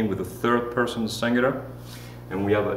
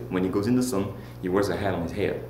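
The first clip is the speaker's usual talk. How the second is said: ordinary delivery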